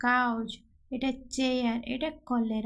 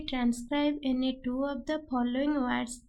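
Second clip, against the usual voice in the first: very slow, word by word; slow question read; much slower than usual. Shown as a demonstration. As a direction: dictate the exam question slowly. Teacher reads exam question aloud.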